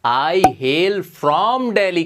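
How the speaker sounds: stretched very slowly, each word apart; slow model phrase. Shown as a demonstration. Teacher modelling a new phrase for students, very slow. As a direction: draw the phrase out for the class. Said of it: "तो आप बोलोगे".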